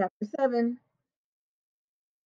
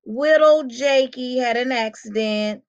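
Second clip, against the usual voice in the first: high, loud mocking baby talk; high-pitched baby-talk teasing; 5 semitones higher, 4 dB louder, slower than usual. A narrator's reading. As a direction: taunt him in a babyish voice. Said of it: "in his baby voice".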